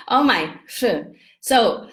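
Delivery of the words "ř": "sh" as r and zh fused; Czech Ř sound. Shown as a demonstration. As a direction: say "sh" as a single short "rzh". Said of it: "R with an H with a Z".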